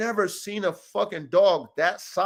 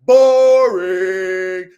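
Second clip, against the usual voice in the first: loud, long drawn-out jeer; mocking heckle; 9 dB louder than usual. Real, not acted. Host trashing today's dog content, drawn-out mocking heckle. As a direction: stretch BORING out on a held note.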